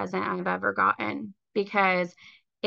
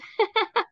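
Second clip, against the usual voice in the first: emotional laugh; 12 semitones higher than usual. Real, not acted. Laughs off near tears.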